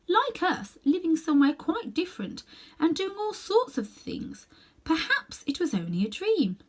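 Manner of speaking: put-on high girl voice, hushed in places; musing young girl; wondering girl. A narrator's reading. Girl's voice in story.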